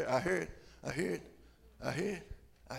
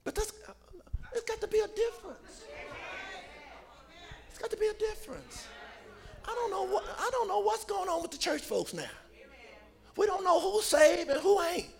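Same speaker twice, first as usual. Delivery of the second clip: high lament; 10 semitones higher, 6 dB quieter than usual. Real, not acted. Preacher's high squealing lament.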